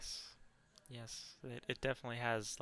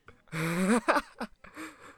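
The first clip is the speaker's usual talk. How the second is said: loud laugh; 11 semitones higher, 10 dB louder than usual. Real, not acted. Big laugh at the no.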